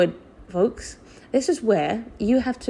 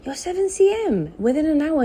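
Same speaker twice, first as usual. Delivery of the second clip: doctor's delight; 9 semitones higher than usual. Retold or imitated. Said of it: "she said".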